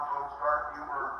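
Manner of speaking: ordinary talk, nothing marked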